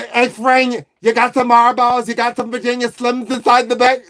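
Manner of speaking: mock Italian-American waitress calling out; Italian woman. Waitress asking Fran for cigarettes, Italian woman's accent. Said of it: "Okay, an Italian woman".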